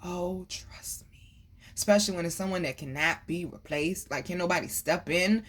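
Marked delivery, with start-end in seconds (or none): 0.0-1.4 breathy hushed aside; whisper. Real, not acted. Whispering to the viewer who is grieving. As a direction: lean in and whisper it.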